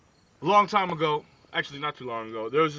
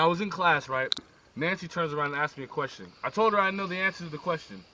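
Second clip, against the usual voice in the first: normal talking voice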